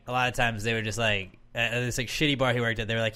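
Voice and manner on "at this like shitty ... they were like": ordinary talk, nothing marked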